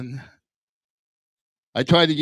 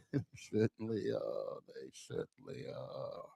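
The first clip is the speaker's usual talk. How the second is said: fading to a whisper; quiet whispered echo; whispered aside; 4 semitones lower, 16 dB quieter than usual. Real, not acted. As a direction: whisper the repeat under the laugh. Repeats tag under laughter.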